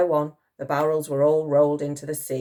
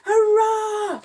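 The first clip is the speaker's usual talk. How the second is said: high, loud hurrah; shouted cheer; 7 dB louder than usual. A narrator's reading. Soldiers celebrating the hit, shouted. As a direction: cheer at full voice. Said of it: "shouted the old soldiers".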